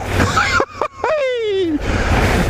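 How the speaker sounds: high squealing laugh. Real, not acted. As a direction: crack up in a high pitch. Cracks up at his own accent.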